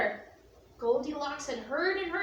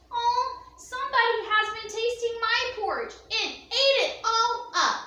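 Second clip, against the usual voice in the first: higher, louder wee bear wail; little wee bear crying out; 7 semitones higher, 5 dB louder than usual. Acted. Little bear finding his empty bowl, high wailing cry. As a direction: cry out like a tiny upset cub. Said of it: "the little small wee bear cried".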